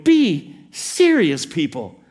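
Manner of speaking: worked-up, exasperated plea; elevated Jesus. Acted. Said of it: "almost hear Jesus getting elevated".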